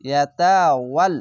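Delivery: ইয়াতাওয়াল recited slowly, vowels drawn out; Quranic recitation. Shown as a demonstration. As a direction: stretch each syllable of ইয়াতাওয়াল. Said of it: "ওয়া লাম জবর ওয়াল". (ধীরে তিলাওয়াত)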